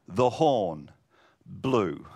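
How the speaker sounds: normal talking voice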